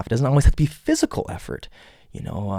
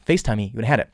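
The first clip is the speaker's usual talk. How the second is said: usual speaking voice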